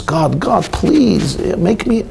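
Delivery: high, begging cry to God; a mock-panicked woman begging God; desperate pleading. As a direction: plead frantically, voice climbing. Woman begging God, joke punchline.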